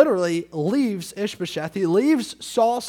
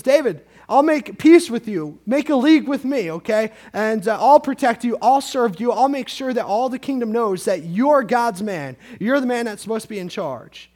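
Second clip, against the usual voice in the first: loud, pushy pitch to David, building higher; eager offer of peace; 4 semitones higher, 6 dB louder than usual. Retold or imitated. Abner pressing David loudly to make a league. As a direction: sell the deal hard and loud. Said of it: "He comes over to David and says".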